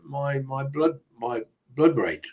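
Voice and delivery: ordinary delivery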